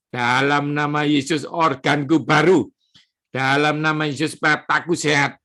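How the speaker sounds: firm, rhythmic declaring in Jesus' name; faith declaration. Acted. Preacher leading congregation in healing declarations, firm and rhythmic. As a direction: proclaim each healing like a decree.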